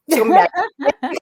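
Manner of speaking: laughing at her own threat; laughing through it. Real, not acted. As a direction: blurt it out while cracking up. Repeats warning, laughing.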